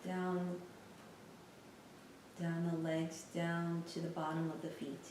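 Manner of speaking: soft, unhurried meditation voice with long pauses; guided meditation. Real, not acted. Teacher guiding listeners through a lying-down body scan, calm meditation voice. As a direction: guide them gently through a body scan, leaving space between phrases.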